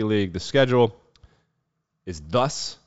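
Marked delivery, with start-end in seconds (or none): none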